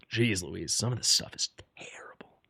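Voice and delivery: unvoiced horrified whisper. Real, not acted. Gamer looking at awful gear, whispering in dismay. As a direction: hush it like a dreadful secret.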